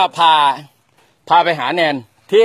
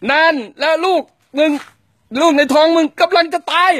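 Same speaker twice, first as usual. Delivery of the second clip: raised, higher angry line for the actor; demo shouted line; 11 semitones higher than usual. Shown as a demonstration. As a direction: bark it out as a threat. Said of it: "แล้วตะโกนบอกว่าไง".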